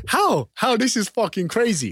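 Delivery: high, loud, astonished questioning; incredulous. Retold or imitated. Reacting to the name Blacktown, high and incredulous. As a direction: punch it out in disbelief. Retold disbelief at the area's name.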